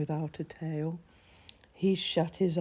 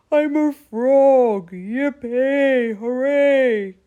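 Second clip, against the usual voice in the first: higher, much louder, slow shout of joy; joyful shout; 7 semitones higher, 10 dB louder, slower than usual. Acted. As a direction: cheer it out loud. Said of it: "he shouted".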